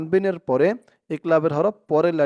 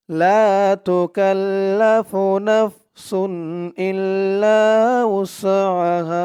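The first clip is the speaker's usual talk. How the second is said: higher, slow model recitation for students to repeat; recite with me; 4 semitones higher, 4 dB louder, much slower than usual. Shown as a demonstration. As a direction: lead the class through the verse in tune. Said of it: "আমার সাথে বলুন". (ছাত্রদের সাথে পড়ানোর মতো তিলাওয়াত)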